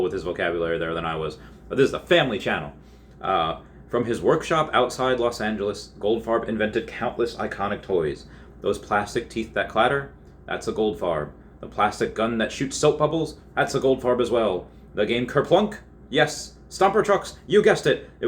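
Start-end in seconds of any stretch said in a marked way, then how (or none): 1.7-2.7 fast joking aside; rushed aside. Real, not acted. Narrator hurrying through a joke about swearing. 14.9-18.1 climbing higher and louder with each toy; building excitement. Real, not acted. Narrator listing Goldfarb's hits with growing excitement.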